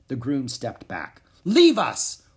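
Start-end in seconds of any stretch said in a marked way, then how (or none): 1.4-2.2 loud, high royal roar. A narrator's reading. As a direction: bellow the dismissal. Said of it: "roared Alexander".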